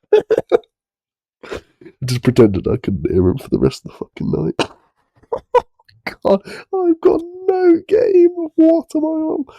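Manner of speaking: high squeaky words through laughter; helpless laughter. Real, not acted. Cringing at his own story, laughing high and helpless. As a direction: laugh it out, embarrassed.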